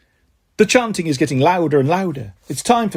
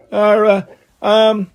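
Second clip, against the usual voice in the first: hesitant, stumbling filler sounds; stuttering; 4 semitones higher, 5 dB louder, much slower than usual. A narrator's reading. Boy faced with a sum he can't do, stuttering. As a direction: stall and stumble over it. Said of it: "I stutter".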